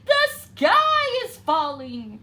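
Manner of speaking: high, loud, slow chorus. A narrator's reading. The birds chorusing to the officer, loud. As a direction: say it together as a panicked group. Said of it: "chorused the birds".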